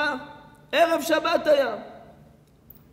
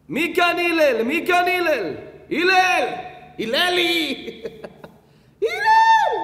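raised, high mock yelling, getting shriller; retold shouting; 6 semitones higher, 4 dB louder, slower than usual. Pest outside shouts for Hillel, mock yell rising high. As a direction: yell the name, climbing to a drawn-out comic screech. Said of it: "פתאום הוא צועק לו".

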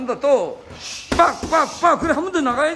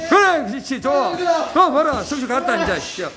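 usual speaking voice

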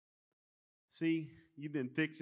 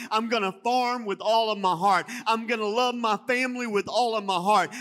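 louder, higher preaching that climbs to the end; full-force preaching; 6 semitones higher, 8 dB louder than usual. Acted. Pastor rallying the church to serve wholeheartedly, preaching hard. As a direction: declare it with all your heart.

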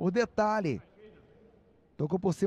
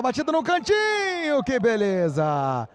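high, thrilled call of the shot; excited goal call; 5 semitones higher than usual. Real, not acted. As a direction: rise with the shot, then savor it. Shot goes in.

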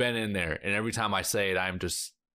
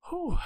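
relieved whew. Real, not acted. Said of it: "what a ride".

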